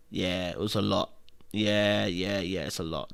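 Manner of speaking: very low, quiet murmur; subdued, drained. Real, not acted. Viewer admitting the film was heavy, quiet and drained. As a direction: trail down soft and low, spent.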